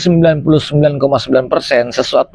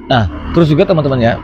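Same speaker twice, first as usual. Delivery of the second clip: ordinary delivery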